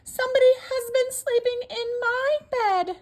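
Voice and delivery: high, loud mama bear; middle-sized mama bear voice. Acted. Mama bear finds her bed slept in, high middle-sized voice. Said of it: "in her middle-sized voice".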